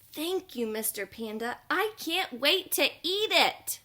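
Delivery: bubbling over with thanks; excited penguin. Acted. Penguin thanks the panda for the donut, high and eager.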